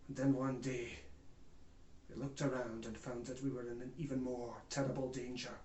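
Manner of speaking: sudden drop to low and soft; grave, hushed realisation; sombre aftermath. Acted. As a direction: let the danger sink in quietly. Sober turn after the climax.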